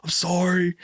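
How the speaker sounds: high-pitched defensive whine; high whiny excuse. Retold or imitated. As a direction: whine the excuses like a scolded kid. Defending himself to Abby.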